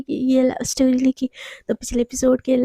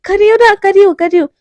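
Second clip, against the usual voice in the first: flustered; 4 semitones higher, 6 dB louder, faster than usual. Acted. Mishka hurries under the gun.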